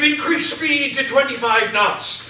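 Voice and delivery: a hint of urgent captain; captain's commands. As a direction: call the orders out to the helm. Captain giving orders in play.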